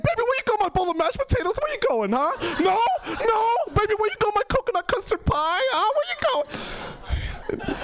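high-pitched frantic calling after a lover; desperate pleading. Retold or imitated. Comedian calling back partner who is leaving, desperate high pleading.

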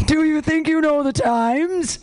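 slow, high-pitched mock question; mocking incredulity. Real, not acted. Host repeats an odd old prompt, drawn-out incredulous mockery. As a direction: stretch it out high, baffled by the wording.

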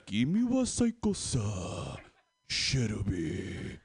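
slow, drawn-out anime Japanese; anime character. Acted. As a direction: sink to a low, lingering "Shirubi". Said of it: "my anime laugh".

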